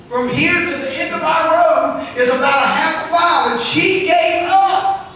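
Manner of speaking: loud, high preacher's cry; full-force preaching. Acted. Preacher crying out to the church about the devil. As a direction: preach it hard to the congregation.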